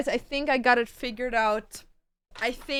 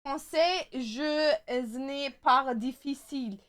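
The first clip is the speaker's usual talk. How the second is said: hammed-up mock French accent, louder; french chef; 3 semitones higher, 9 dB louder than usual. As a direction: ham up a fake French accent. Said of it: "I'm just making words up".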